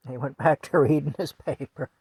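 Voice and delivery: ordinary delivery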